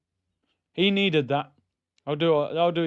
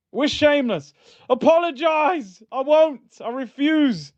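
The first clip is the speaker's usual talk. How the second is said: high, loud playful refusal; jokingly stubborn, high and loud; defiant; 9 semitones higher, 4 dB louder than usual. Real, not acted. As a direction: refuse to say sorry with cheeky pride.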